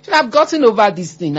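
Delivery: a hint of a scolding relative; mock impatient scold. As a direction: ham up a nagging busybody. Mimicking impatient advice-givers.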